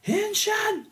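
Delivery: very high-pitched hero cry; mock transformation call. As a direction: squeal the Rangers' command. Imitating Rangers' transformation call.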